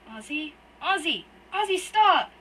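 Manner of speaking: building from a scared call to a loud, high scream; frantic yell. Acted. Waking boy begs his knife-holding brother, building to a frantic scream. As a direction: panic rising until he screams stop. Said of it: "Merrick yells frantically".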